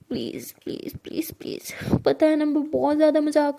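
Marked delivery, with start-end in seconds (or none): 0.1-1.7 put-on whiny little-kid please, please, much softer; childlike pleading. A narrator's reading. Saniya begging Zoya like a child to forgive her. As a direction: beg like a small child. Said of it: "बच्चों की तरफ please, please कर रही होती है".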